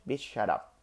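voiced, firm mock put-down; mock telling off. To someone stigmatising men crying, a mild mock retort. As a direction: say it like you're shutting someone down. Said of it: "you have to tell, to tell that person".

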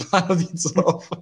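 repeats it while laughing; chuckling through the words. Real, not acted.

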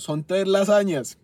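slow it down and push it out loud; emphatic order. Retold or imitated. Customer ordering three lasagnas from waitress, loudly insistent.

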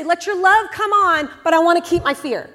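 mock plea to keep the fear; mock bargaining. Mocking a bargaining believer.

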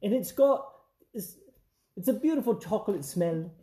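mock bake-off judge praising the cake in a British accent. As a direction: do your best British baking judge. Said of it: "I don't know how to do an accent".

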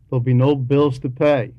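ordinary talk

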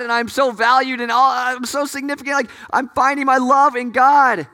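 high, insistent, pleading torrent of thought; tempted woman's inner protest. Acted. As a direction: argue with yourself, pitched high and urgent.

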